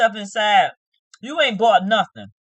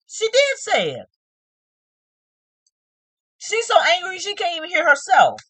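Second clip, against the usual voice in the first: ordinary delivery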